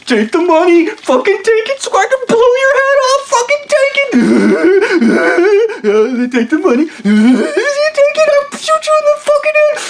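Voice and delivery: high screaming villain with cackles; hammed-up unhinged screaming gunman; maniacal threat. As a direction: shriek the threat like a madman. Stu with gun screaming.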